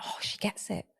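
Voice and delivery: hushed, relieved little voice; a soft sigh of relief; whispered child relief. Retold or imitated. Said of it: "the child feel like".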